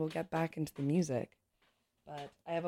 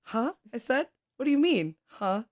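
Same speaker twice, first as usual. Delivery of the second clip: higher, louder, baffled demand; indignant surprise; 8 semitones higher, 7 dB louder than usual. Acted. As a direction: push back with a confused huh. Baffled reply after a kiss.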